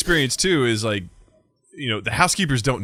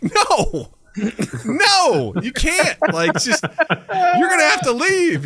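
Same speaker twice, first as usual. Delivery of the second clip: high, drawn-out mock refusal with laughter; acted-out incredulous "no"; exasperated refusal; 12 semitones higher, much slower than usual. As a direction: refuse ever higher, half laughing. Refusing pimp in acted quarrel.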